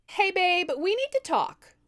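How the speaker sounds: high, loud sing-song warning; ominous sweetness. Acted. Wife greeting her husband with an ominous sing-song. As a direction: sweetly announce trouble.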